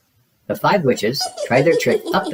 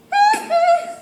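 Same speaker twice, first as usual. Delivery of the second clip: very high witchy cackle; short high cackle; witch cackle. Acted. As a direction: shriek a quick witch cackle. Witch cackle after pun.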